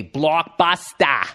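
slow, stretched Australian "blockbuster"; exaggerated Australian drawl on "blockbuster"; Australian accent. Shown as a demonstration. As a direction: drag out each vowel of "blockbuster", ending in a long "-tah", Australian style. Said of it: "They just stretch it out a little bit more".

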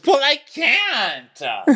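high, slow, stretched-out wail; hammed-up quarrel outburst; acted exasperated yell. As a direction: drag out the last word in mock outrage.

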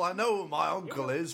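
ordinary talk, nothing marked

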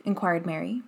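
plain reading voice

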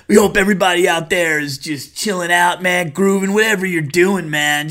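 hammed-up chill radio host; cheesy radio DJ. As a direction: groove it like a seventies jock. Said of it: "continues radio DJ bit".

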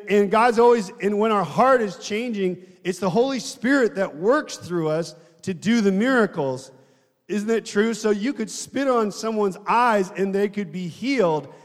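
usual speaking voice

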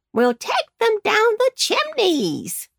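high squawky crow. Acted. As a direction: talk like a big crow. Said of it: "called the crows".

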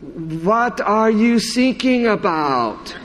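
put-on slow, puzzled German accent; German accent punchline. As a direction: mishear thinking as sinking, deadpan German. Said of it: "And he goes".

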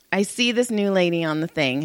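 normal talking voice

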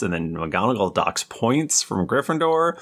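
normal talking voice

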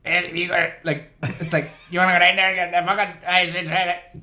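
imitating a mumbling bar regular. Telling a mate about the inaudible man at the pub, mocking mumble. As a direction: do the guy whose words nobody can make out. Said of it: "if I said he talked like".

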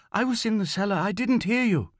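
high-pitched pleading explanation; insistent excuse. A narrator's reading. Young man explaining to the officer, insisting. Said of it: "the young man insisted".

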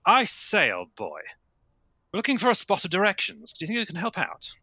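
hammed-up tweedy English toff; posh English gentleman. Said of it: "No, I'm English, don't you know?".

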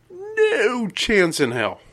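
"No" stretched out long and high; emphatic refusal. Real, not acted. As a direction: draw out "No" high, then drop through the rest. Emphatic answer to own question.

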